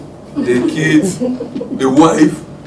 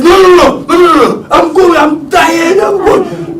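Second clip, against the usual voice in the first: higher, loud, exasperated dad yelling no and leaving; mock angry shout; 13 semitones higher, 7 dB louder than usual. Dad shouting at his begging kids, mock angry yell. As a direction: belt it out like a fed-up father storming off.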